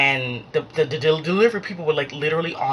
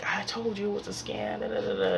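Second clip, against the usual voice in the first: ordinary talk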